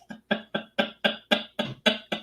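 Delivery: long breathy chuckle; laugh. Real, not acted. Laughing at friend's mistaken stag pick.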